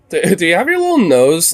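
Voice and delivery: high, cooing pet voice. Real, not acted. Owner cooing at his dog's little nose, high. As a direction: coo at the dog sweetly.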